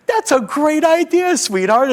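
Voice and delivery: bright high overly sweet encouragement; fake parental praise. Dad praising daughter's idea, high fake cheer. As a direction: gush to a six-year-old, high and sunny. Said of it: "as a good parent does to their six-year-old".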